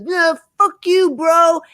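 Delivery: high, drawn-out bro yell; mock drunken screaming. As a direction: imitate drunk dudes yelling in a bar. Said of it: "screaming at each other".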